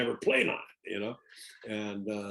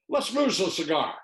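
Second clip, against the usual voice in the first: retold director; 8 semitones higher, 6 dB louder than usual. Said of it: "He goes".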